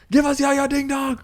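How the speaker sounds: high, hammed-up movie yell; mock yell. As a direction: belt it out like the film's big finale. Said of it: "the guy just yells".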